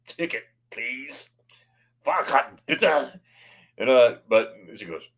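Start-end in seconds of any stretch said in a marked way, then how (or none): 0.1-3.2 imitated conductor, slow and deliberate; mock-stern German ticket check; stern ticket inspector. Ticket lady checks passengers on the train, mock stern. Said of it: "stern look".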